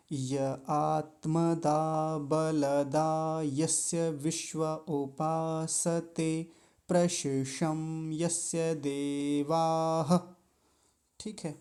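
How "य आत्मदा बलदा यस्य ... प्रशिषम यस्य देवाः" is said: whole line slowly, each word distinct; slow full line recitation. Shown as a demonstration. Teacher reciting the full mantra line for students. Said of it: "एक बार पूरी पंक्ति को देख लीजिए".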